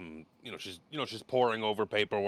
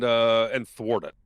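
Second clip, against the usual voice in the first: ordinary delivery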